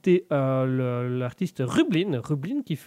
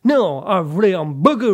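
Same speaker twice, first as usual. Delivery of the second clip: loud, slow, hammed-up American; brash stage-American French; American accent; 2 semitones higher, 8 dB louder, slower than usual. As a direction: belt it out in a mock American accent. Puts on American McDonald character.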